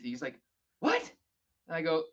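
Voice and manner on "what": sharp high "What?"; baffled what. Retold or imitated. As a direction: squeak it in disbelief. Keith baffled.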